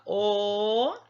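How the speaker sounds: long held "o", gliding upward; isolated syllable. Shown as a demonstration. Teacher places one syllable per box, "o" held long. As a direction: sound out "o" alone, stretched. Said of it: "quando a gente fala".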